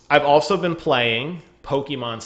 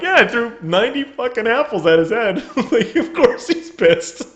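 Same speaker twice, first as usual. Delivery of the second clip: higher and louder through laughter; giggling, raised mock reply; laughing retort; 9 semitones higher, 5 dB louder than usual. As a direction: answer the professor while cracking up. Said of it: "I'm like".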